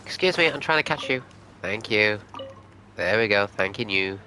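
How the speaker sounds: ordinary delivery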